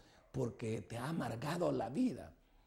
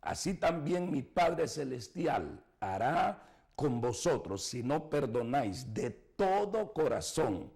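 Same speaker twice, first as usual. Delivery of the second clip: normal talking voice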